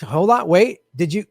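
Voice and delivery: ordinary talk, nothing marked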